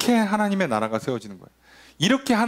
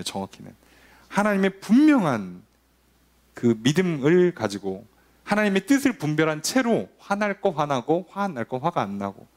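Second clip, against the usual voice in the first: ordinary talk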